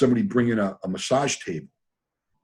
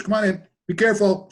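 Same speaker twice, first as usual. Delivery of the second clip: Richard's warning; 10 semitones higher, 3 dB louder than usual. Retold or imitated. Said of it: "Richard goes".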